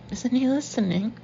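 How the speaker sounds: usual speaking voice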